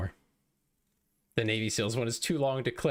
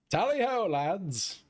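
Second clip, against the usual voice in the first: British cry; 13 semitones higher, much slower than usual. Acted. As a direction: bellow it like a cheery redcoat. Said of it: "fantastic voice work".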